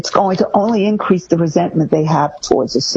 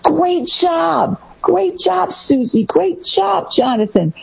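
high, sing-song great job; mock-excited parent praising a toddler, high; gushing praise; 11 semitones higher, slower than usual. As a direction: gush over the toddler like a cheerleader. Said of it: "You hear parents saying".

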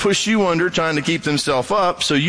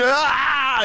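mock pain yelp; 10 semitones higher than usual. Mimics arm-whipping pain.

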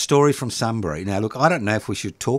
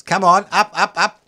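loud, higher coaxing call; urging on; 9 semitones higher, 6 dB louder than usual. Real, not acted. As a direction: egg the screen on, louder and higher. Urging text messages to scroll.

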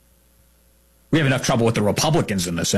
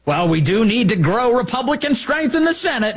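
mock Cornyn; 9 semitones higher than usual. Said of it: "Cornyn's position is".

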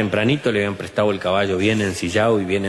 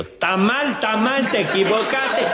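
high, frantic shouts while running; high comic yelling, running up waving the book; shouting; 13 semitones higher, slower than usual. Retold or imitated. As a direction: yell that he got it wrong. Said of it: "a los gritos y flameando el libro".

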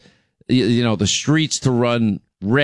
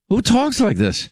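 high-pitched, baffled outburst; incredulous; 10 semitones higher than usual. Real, not acted. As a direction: pitch leaps high in disbelief. Incredulous repeat after the clip.

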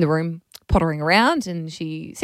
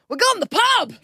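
put-on high, bright little-kid announcement; toddler voice. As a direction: deliver it like a proud three-year-old. Mum voicing three-year-old's punchline.

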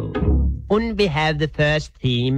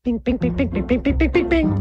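very high plucked bings climbing to a squeak; ping out a tiny fiddle theme, rising; little fiddle imitation; 8 semitones higher, faster than usual. Storyteller plays the fiddle's theme, high pinging voice. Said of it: "the theme of the little fiddle".

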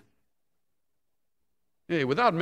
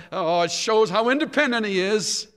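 mock indulgent parent; 7 semitones higher, 3 dB louder than usual. Mocking a dismissive parent's excuse.

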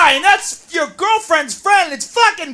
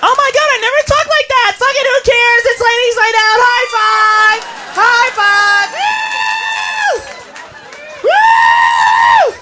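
put-on drunk party girl; 4 semitones higher, slower than usual. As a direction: yell over the club music.

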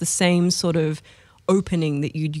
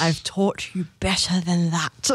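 stern mum voice. Retold or imitated. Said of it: "my mum in the back of my head going".

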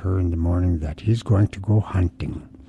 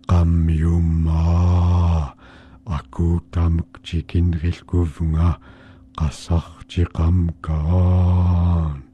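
very slow, eerie drawn-out chant; strange voice; 2 semitones lower, much slower than usual. Acted. Monster at Mink's door calling in Yupik, slow eerie chant. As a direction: intone it like something lurking outside the door. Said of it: "there was a strange voice".

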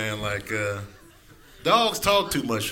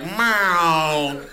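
a flat once-a-month meow; lazy cat meow; 3 dB louder than usual. Pretend. Cat asking once a month, lazy meow. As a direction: meow it like you can't be bothered. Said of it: "They'd be like".

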